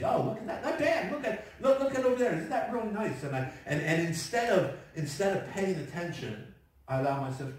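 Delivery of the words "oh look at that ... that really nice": high, delighted exclaiming at the view; playful mock wonder, pitched well up; sightseeing driver. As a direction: gush over the scenery like a distracted tourist. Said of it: "Because I sightsee".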